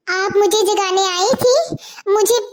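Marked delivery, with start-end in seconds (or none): none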